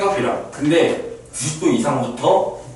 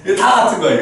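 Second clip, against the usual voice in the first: excited; 6 semitones higher, 5 dB louder, faster than usual. Real, not acted. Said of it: "또 급발진해".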